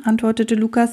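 ordinary delivery

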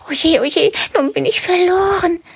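very high, panicked rabbit thought; a rabbit sure he's doomed; despairing rabbit. Acted. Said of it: "denkt Karl-Heinz". (hohe, verzweifelte Kaninchenstimme)

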